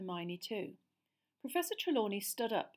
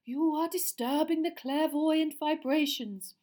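slow, high, dreamy mystic voice; dreamy seer; 8 semitones higher, 8 dB louder, slower than usual. A narrator's reading. Seer speaking dreamily to her class. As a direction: float each word out like a seer in a trance. Trelawney voice.

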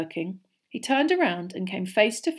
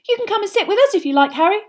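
put-on eager young fan; 13 semitones higher, 9 dB louder, faster than usual. A narrator's reading. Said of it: "Colin looked eagerly around".

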